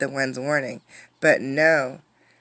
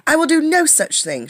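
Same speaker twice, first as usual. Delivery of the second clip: high, loud, defiant snap; snapped; 12 semitones higher, 6 dB louder than usual. Acted. Irene snaps back at the wind, loud and defiant. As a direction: snap it right back, stubborn. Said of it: "she snapped".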